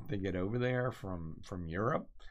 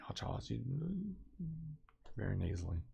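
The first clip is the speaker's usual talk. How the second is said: much softer, lightly imitated mother; friend's mother; 3 semitones higher, 11 dB quieter, faster than usual. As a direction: quietly mimic a friend's mom's critical remark. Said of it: "just like that. She would just say it like that".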